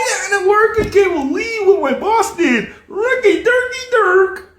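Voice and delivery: very high, gushing airhead chatter; mock happy American. Showing how happy Americans talk, mock happy falsetto. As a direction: parody a delighted consumer in a squealy falsetto. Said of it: "if you're happy, all you do is this".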